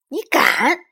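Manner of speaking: loud, high angry challenge; flaring up. A narrator's reading. Little Bear defies Lizi's threat, flaring up angrily. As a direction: dare them, furiously. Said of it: "小熊妹妹火了".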